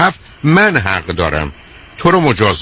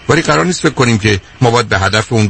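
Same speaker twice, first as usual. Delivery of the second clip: usual speaking voice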